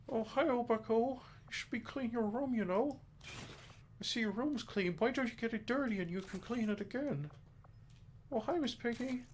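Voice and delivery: high, squeaky frog puppet voice; Kermit the Frog. Retold or imitated. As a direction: talk like a cartoon frog puppet. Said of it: "sound like Jordan Peterson and Kermit the Frog".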